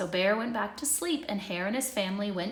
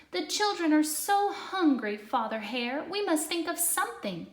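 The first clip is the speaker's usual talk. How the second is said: pleading, higher mother hare; a worried mother hare begs; Missus Hare crying out; 7 semitones higher than usual. A narrator's reading. Missus Hare begs Father Hare about hungry children, pleading. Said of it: "Missus Hare cried out one day".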